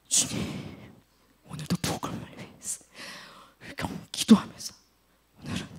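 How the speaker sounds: soft, hushed whisper of prayer. Retold or imitated. Preacher voices Paul praying softly in prison, whispering. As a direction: whisper a devoted morning prayer.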